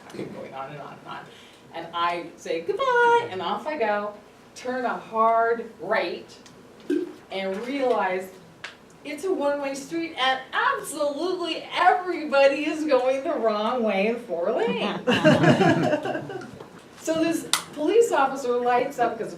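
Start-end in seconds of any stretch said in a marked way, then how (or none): 2.6-3.3 much higher, louder sing-song goodbye; chirpy goodbye. Retold or imitated. Cheerful farewell to ticket guy. 15.1-17.0 high, loud laughter. Real, not acted. Laughing at own story.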